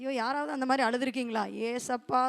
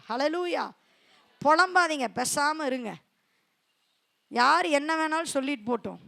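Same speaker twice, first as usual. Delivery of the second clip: usual speaking voice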